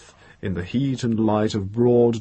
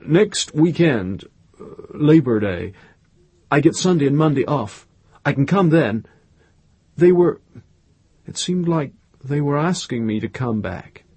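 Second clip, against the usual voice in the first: halting, higher, feeling out each thought; hesitant, broken-up musing; thinking aloud slowly; 5 semitones higher, 5 dB louder than usual. A narrator's reading. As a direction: piece it together aloud, with pauses. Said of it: "He was thinking aloud slowly".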